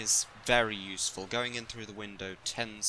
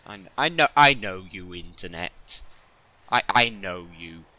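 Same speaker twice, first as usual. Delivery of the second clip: loud mock accusation; 12 dB louder than usual. Teasing the internet's dirty minds.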